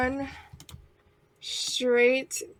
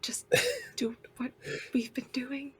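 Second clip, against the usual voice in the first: whisper; 7 dB quieter than usual. Acted. Whispered reassurance in the dark.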